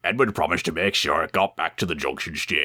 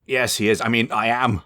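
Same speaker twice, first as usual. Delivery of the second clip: flustered stammer; stuttering; 6 semitones higher than usual. Acted. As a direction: trip over the words. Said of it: "stuttered James".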